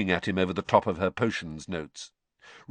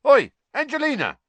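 high, loud call across a room; 8 dB louder than usual. A narrator's reading. Fred hailing Angelina across the common room, calling out. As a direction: holler to get her attention. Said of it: "called across the common room".

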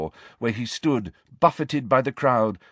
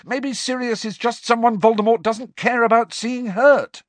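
loud, high angry retort; yelling; 13 semitones higher, 6 dB louder than usual. A narrator's reading. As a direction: keep yelling, voice pitched up. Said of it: "Harry yelled at her".

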